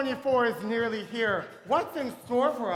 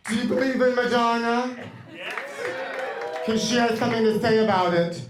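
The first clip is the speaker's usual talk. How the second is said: loud announcer intro; 2 semitones higher, 8 dB louder than usual. Acted. Announcing [name]'s entrance.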